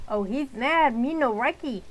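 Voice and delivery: the bully keeps mocking her; mock broken English. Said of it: "I don't sound like that".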